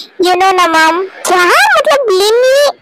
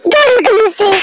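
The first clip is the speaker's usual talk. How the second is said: huffy; 5 semitones higher, slower than usual. Acted. Wife pushes husband away.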